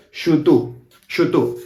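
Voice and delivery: shutu as "shoo-TOO", ending on the open vowel; dropped final B. Shown as a demonstration. Teacher giving the form they mostly hear, Argentinian pronunciation. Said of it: "I mostly hear shutu".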